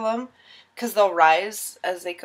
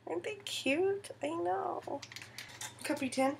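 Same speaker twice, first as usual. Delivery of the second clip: cutesy coo; 8 semitones higher, 11 dB quieter than usual. Real, not acted. Cooing over princess tins.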